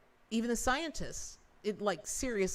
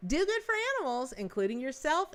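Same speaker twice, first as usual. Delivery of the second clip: much higher, fast, bouncy sign-off; sign-off catchphrase; 7 semitones higher, 3 dB louder than usual. Real, not acted. Ending her stream with the usual catchphrase, much higher and bright. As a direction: sing out your trademark goodbye. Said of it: "so how do we always end?".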